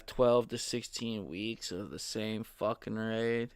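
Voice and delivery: drowsy, nodding-off voice; falling asleep. Real, not acted. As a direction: talk while falling asleep. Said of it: "I'm falling asleep".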